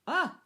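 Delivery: loud, high startled yelp; scary-movie scream. Acted. Moviegoer as bad guy jumps out, startled cry. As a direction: cry out as at a jump scare. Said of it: "you say when you're watching a scary movie".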